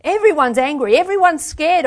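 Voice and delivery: high, loud outburst; angry shout. A narrator's reading. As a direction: shout it out, unable to stop. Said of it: "I shouted".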